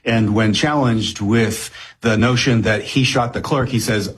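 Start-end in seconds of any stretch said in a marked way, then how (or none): none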